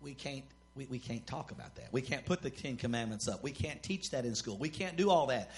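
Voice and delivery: very low, soft undertone; mock hushed. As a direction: say it as if it's forbidden. Mock hushing of forbidden topic.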